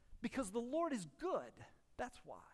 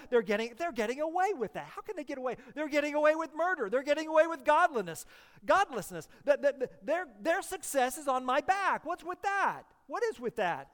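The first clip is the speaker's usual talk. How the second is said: mock indignant complaint; 5 semitones higher, 7 dB louder than usual. Voicing the envious complainer.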